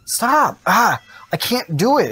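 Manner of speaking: high panicked cry of stop; scared shout, real; startled scream fading into despair; frightened yelp. Real, not acted. Monster attacks in horror game.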